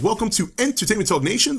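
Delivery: ordinary delivery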